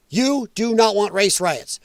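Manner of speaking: ordinary talk, nothing marked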